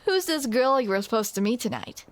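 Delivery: ordinary talk, nothing marked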